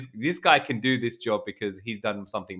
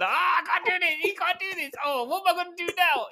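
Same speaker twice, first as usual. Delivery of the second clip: put-on high whiny child's wail, much higher; panicked little child. Inner voice of the customer panicking like a child. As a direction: whine like a scared kid. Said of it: "screaming like a little child".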